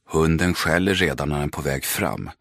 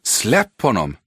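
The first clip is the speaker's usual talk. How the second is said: shout; 5 semitones higher, 4 dB louder than usual. Acted. Leo confronts bully holding brother.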